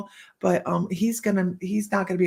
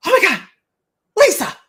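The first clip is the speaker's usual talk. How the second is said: loud, high shout of recognition; yelling a name; 10 semitones higher, 8 dB louder, faster than usual. Retold or imitated. A woman spots the speaker at the show, yelling her name. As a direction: call out as if spotting someone across the show. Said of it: "she yelled my name".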